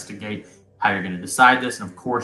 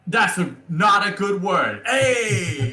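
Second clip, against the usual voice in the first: put-on raised-pitch comic Italian accent; 8 semitones higher, 3 dB louder, slower than usual. Bobo objecting to being called a snitch, comic Italian accent. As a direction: Bobo protesting the word snitch.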